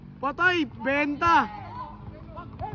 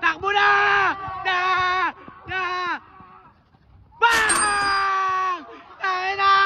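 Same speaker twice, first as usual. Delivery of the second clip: excited play shouting; 3 semitones higher, much slower than usual. Real, not acted. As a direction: urge the runners on with long shouts. Urging players on a fast break.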